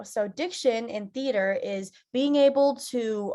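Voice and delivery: ordinary delivery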